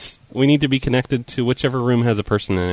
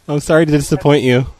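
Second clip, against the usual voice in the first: ordinary delivery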